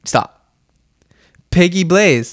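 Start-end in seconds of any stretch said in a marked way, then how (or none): 1.5-2.3 mock French accent on the name. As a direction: announce the name with a French flair. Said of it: "I'm gonna do a random French name generator".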